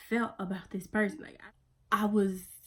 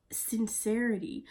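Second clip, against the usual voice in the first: ordinary talk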